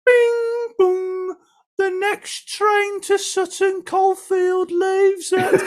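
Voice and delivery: very high, slow mock train announcement; chime, then drawn-out Brummie tannoy parody; station announcer. Train station announcement undercutting the girlfriend, mock announcer voice. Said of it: "the big Birmingham train station at the time".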